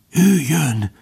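high, hushed alarm; thin, pressing warning; urgent whispering. A narrator's reading. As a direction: warn urgently under your breath. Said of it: "suttogta sürgetve".

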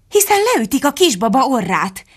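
high-pitched exclamation of alarm; alarmed cry. A narrator's reading. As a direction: cry out to protect the baby. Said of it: "kiáltotta".

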